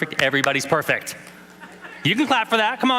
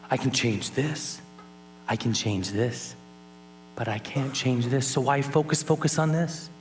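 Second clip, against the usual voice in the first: softer, slow, patient voice of his mom; mom's calm wisdom; 4 semitones lower, 3 dB quieter than usual. Retold or imitated. Son recalls his mother's advice, softly and slowly. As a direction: lay each line down gently like his mother did. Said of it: "My mom would always say to me".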